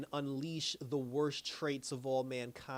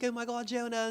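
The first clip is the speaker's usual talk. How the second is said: higher-pitched retold alarm; understated panic in a raised pitch; mock alarm; 8 semitones higher than usual. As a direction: lightly act out a worried cry, pitched up. Retold frantic search for Jonah.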